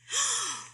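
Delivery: quick high gasp; a startled little gasp; surprised gasp. A narrator's reading. Horton sees the little who.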